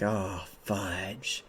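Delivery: soft, low, let-down mutter; deflated. Retold or imitated. Streamer learns the sighting is fake, acted quiet dismay. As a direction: drop right down in quiet dismay. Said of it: "I was so sad".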